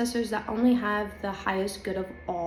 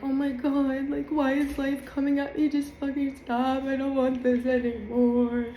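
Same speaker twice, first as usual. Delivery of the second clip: higher, frustrated venting; exasperated outburst; 4 semitones higher than usual. Retold or imitated. Retelling her victim-mode meltdown, exasperated and higher. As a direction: throw up your hands at life. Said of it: "and I was like".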